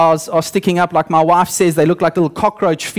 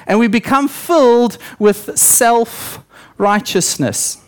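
usual speaking voice

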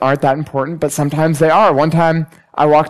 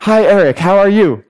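lightly voiced cheery guard greeting, higher; higher, friendly imitation of a greeting; guard's halting greeting; 4 semitones higher, 3 dB louder than usual. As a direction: imitate a guard's simple English hello. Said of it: "speaks enough English that when I walk in".

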